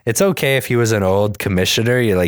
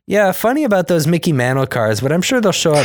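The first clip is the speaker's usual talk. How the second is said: sly high voice; 8 semitones higher than usual. Retold or imitated. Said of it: "He's like".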